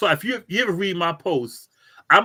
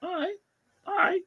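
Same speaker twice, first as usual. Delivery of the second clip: high, soft, pleased little nods of approval; a hint of satisfied approval; approving murmur; 11 semitones higher, 6 dB quieter than usual. Reading a neatly spaced post, softly pleased. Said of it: "I'll be like".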